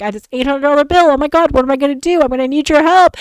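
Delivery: fast, high, frantic pleading; panicky worry. Retold or imitated. Begging her boyfriend for help with the bill, fast and panicky. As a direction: rush it out in a panic. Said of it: "And I'm like".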